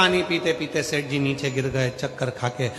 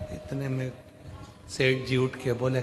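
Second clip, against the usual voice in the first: ordinary talk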